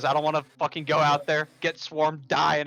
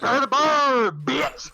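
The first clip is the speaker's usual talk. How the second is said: battle cry; 10 semitones higher, 5 dB louder, much slower than usual. Acted. Battle cry while torching forest.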